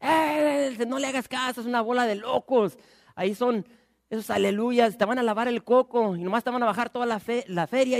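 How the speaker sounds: high scoffing gossip voice; playful mock of a busybody. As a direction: sneer at the believers. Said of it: "un chismoso que está ahí escuchando".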